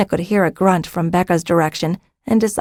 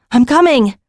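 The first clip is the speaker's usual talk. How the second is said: calling out; 6 semitones higher, 4 dB louder than usual. A narrator's reading. Calls to whimpering child.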